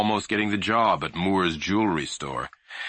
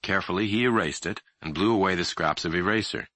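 usual speaking voice

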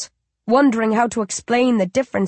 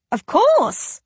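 put-on high, eager child squeal; excited squeal; 12 semitones higher than usual. A narrator's reading. Baby squeals to its mother, eager to serve. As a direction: burst out eager to help. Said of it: "it squeals".

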